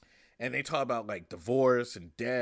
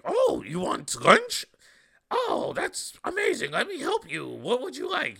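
overeager puppet waiter, pitched way up; Grover voice; 12 semitones higher, 6 dB louder than usual. Retold or imitated. Stand-up imitating the waiter Grover, high and loud. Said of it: "Grover would be like".

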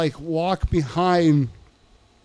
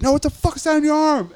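much higher, louder imitated demand; insistent challenge; 12 semitones higher, 5 dB louder than usual. Inmate pressing him about the tattoo, insistent challenge. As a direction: press him, brushing his answer aside.

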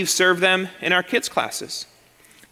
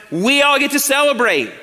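rousing shout; 7 semitones higher, 4 dB louder than usual. Real, not acted. Preacher rallying congregation.

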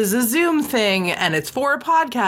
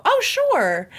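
mock innocent reply; 11 semitones higher than usual. Acting out her own innocent reply.

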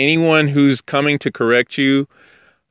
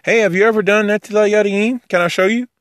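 high, light, quick friendly invitation; friendly offer; 11 semitones higher, faster than usual. Retold or imitated. Kind teacher offering to show a newcomer the ritual, friendly. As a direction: offer help in a much higher, unthreatening voice. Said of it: "this is a beautiful time to teach them".

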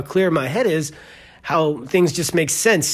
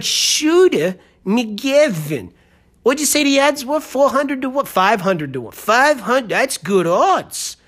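high, stretched-out imitation of a Boston-like gambler; exaggerated near-Boston accent; not-quite-Boston accent; 6 semitones higher, slower than usual. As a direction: do a rough Boston accent, pitched up and drawn out. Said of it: "It's not quite Boston".

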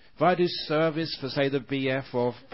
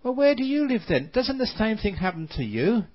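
high, quick disbelieving question; doubtful spider; 8 semitones higher than usual. Acted. Sad spider questions the fat spider, high and fast. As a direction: squeak it out in disbelief. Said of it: "happy spider and said".

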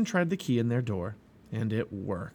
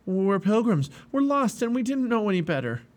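higher and louder, frightened plea; a scared pilgrim begging the giant; terrified; 8 semitones higher, 4 dB louder than usual. Acted.

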